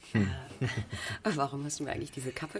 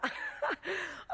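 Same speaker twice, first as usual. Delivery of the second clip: high giggle; 4 dB quieter than usual. Acted. Laughs at the cap.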